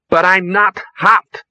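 high, loud, slow protest; protesting young woman. A narrator's reading. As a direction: object in bewildered indignation. Misunderstands fans, protests loudly.

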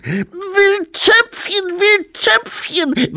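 put-on very high, slow, loud toddler whine; drawn-out squealing baby demand; baby demanding potty. A narrator's reading. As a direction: wail for it like an insistent infant. Baby abbot demands his potty.

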